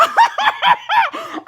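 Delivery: real burst of high-pitched laughter; high cackling laugh. Real, not acted. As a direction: crack up at your own story. Laughs at own chase story.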